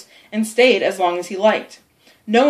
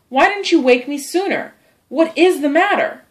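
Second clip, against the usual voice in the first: higher, anxious questioning; worried; 7 semitones higher than usual. Acted. Sid to his supposedly suffering brother, worried. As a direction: press him with growing worry.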